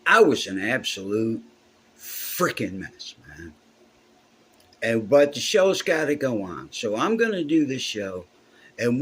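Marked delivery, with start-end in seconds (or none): none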